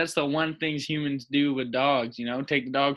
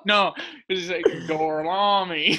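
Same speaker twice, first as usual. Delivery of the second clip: slow, higher, laughing fake Italian Gorlami; laughing mock Italian; 4 semitones higher, slower than usual. Cohost joking about a Brad Pitt scene, laughing mock Italian accent. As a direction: giggle through a bad Italian Gorlami. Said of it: "like Brad Pitt in Inglourious Basterds".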